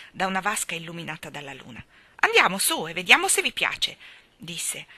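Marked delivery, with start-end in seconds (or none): 2.2-4.0 louder, higher harsh taunt; angry. A narrator's reading. Seal taunts Jane and Michael, angry and loud. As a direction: challenge them spitefully. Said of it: "Era una voce dura e arrabbiata".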